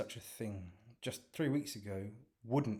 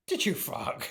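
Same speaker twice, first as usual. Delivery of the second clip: laughing disbelief; 10 semitones higher, 7 dB louder than usual. Acted.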